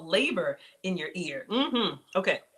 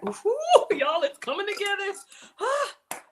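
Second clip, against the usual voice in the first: much higher, louder delighted ooh; real delight at the finished dish; thrilled squeal; 11 semitones higher, 4 dB louder, slower than usual. Real, not acted. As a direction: squeal with joy as it comes together. Dish comes together.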